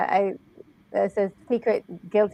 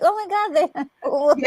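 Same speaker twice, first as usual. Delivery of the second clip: excited; 8 semitones higher, faster than usual. Retold or imitated. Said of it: "was like".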